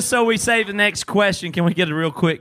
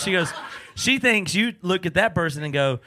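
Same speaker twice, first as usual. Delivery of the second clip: ordinary talk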